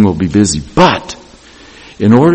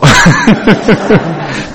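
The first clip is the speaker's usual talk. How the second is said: real laugh; 8 semitones higher, 4 dB louder than usual. Real, not acted. Laughing at his own story.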